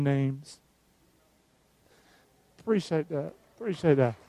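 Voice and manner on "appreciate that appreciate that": much softer, almost under breath; soft murmured thanks. Real, not acted. Pastor thanking the congregation in a soft murmur. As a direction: murmur it gently to the room.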